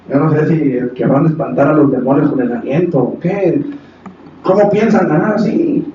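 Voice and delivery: normal talking voice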